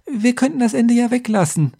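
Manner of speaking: high-pitched, tentative peacemaking; placating. A narrator's reading. Scribe calms the two quarrelling heroes, placating. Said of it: "versuchte ich zu beschwichtigen".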